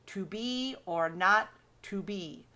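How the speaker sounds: "to be or not to be" slow, in light-heavy pairs; chopped into feet. Shown as a demonstration. Teacher demonstrating iambic feet, chopped slowly into pairs. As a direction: chop "to be or not to be" into two-syllable beats. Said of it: "how you would chop it up".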